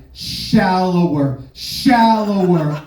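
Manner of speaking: drawn out, slow "Shallower"; mock bedroom plea, slowed right down. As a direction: stretch "shallower" out slowly. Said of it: "I'm like".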